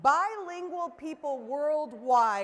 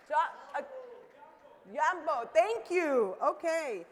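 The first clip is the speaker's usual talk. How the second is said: ordinary delivery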